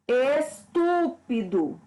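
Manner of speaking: slow, syllable-split "Estúpido", TU standing out; stress on TU. Shown as a demonstration. Teacher showing which syllable is tonic, slow "Estúpido" stressed on TU. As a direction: break up "Estúpido" and lean on TU. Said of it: "porque a tônica é tu".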